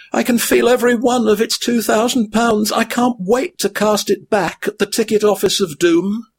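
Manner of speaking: high weary hobbit voice; a high-pitched hobbit worn out by his burden; weary Frodo. A narrator's reading. Frodo's held figure voice.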